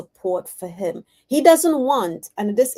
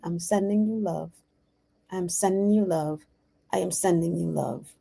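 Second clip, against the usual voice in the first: softer, lower soothing repetition; tender reassurance; 4 semitones lower, 4 dB quieter than usual. Retold or imitated. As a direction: soothe someone in a hug, sinking lower each time. Retold soothing during a hug.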